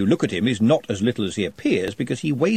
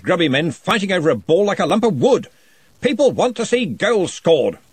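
louder, higher, hearty interrogation; booming wizard questioning; 7 semitones higher, 4 dB louder than usual. Acted. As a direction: press the shy goblin with jovial authority. Ridcully declaims enthusiastically.

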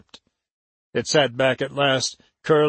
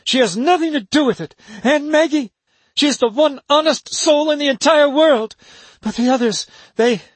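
much higher, louder, fast, harsh defence; harsh ringing voice; 12 semitones higher, 5 dB louder than usual. A narrator's reading. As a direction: defend her loudly and fast. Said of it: "in a voice suddenly harsh and ringing".

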